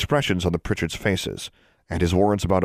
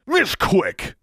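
thundering rebuke; 14 semitones higher than usual. A narrator's reading. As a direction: shut her down like a stern elder. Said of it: "Noah thundered".

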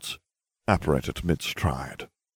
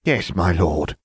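sullen; 4 dB louder, slower than usual. Acted. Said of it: "bowed her head sullenly".

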